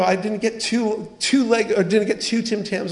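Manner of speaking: high-pitched mock child's grievance; upset little kid. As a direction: complain like a child short-changed on treats. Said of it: "they're crying".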